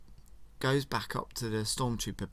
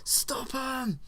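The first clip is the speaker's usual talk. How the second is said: playful high yelling soldiers; baddies shouting; 11 semitones higher than usual. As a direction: raise the alarm like a guard. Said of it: "all the baddies are going".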